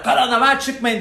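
hammed-up alarm shout with a laugh; mock shouting. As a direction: parody their shouted warning. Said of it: "diye bağırın".

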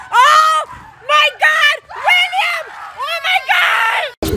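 shocked shout. Real, not acted. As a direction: gasp it out in disbelief. Shocked at William stuck.

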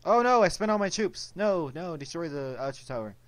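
loud, high cry of dismay; real panic. Real, not acted. Gamer out of troops mid-attack, loud panic. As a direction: blurt it out high, then settle slightly.

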